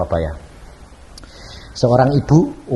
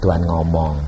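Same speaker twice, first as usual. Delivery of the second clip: ordinary talk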